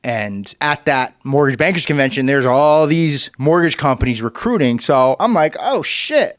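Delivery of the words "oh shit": excited realization. Retold or imitated. Realizing he's a free agent.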